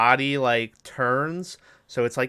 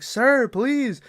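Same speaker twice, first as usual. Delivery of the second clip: high, pleading mock cry; calling for help; 11 semitones higher, 4 dB louder than usual. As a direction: beg from inside the garbage truck. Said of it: "he starts calling for help".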